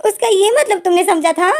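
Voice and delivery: embarrassed Wing protests to Pem in a hammed-up wail; mock wail. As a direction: whine it like a hammed-up sob. Wing mortified by the misunderstanding.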